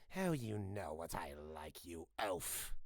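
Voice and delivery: low, soft, scornful character voice; Vile's voice. Retold or imitated. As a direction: sneer it in a low, soft villain voice. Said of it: "just tell Vile".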